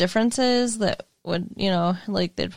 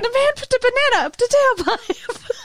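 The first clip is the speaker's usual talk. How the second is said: laughing; 12 semitones higher, faster than usual. Real, not acted. Cracking up telling joke.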